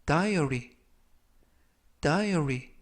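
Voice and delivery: diary said slowly and clearly as DYE-uh-ree, model pronunciation; careful English pronunciation. Shown as a demonstration. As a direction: say diary like a teacher modelling it for students. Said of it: "diary라고 발음하시면".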